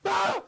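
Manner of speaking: very high yelp of fright; sudden high-pitched shriek; startled scream. Acted. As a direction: scream back in alarm. Snake screams back in fright.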